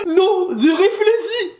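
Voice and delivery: high, slow, drawn-out protest; hammed-up irritation while insisting you're only thinking; comically worked-up retort; mock annoyed. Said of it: "j'ai l'impression que t'es énervé".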